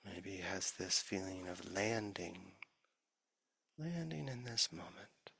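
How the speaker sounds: soft, unhurried meditation voice with long pauses; guided meditation. Real, not acted. Teacher leading the sangha's formal practice, soft guided meditation. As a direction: ease listeners gently into stillness.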